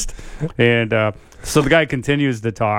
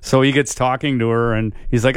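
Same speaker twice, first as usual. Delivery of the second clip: ordinary talk, nothing marked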